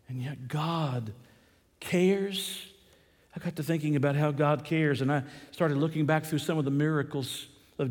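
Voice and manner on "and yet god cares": slow, low and soft; hushed awe. Real, not acted. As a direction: drop right down and slow the words. Awed drop after the shout.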